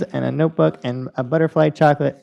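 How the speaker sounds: normal talking voice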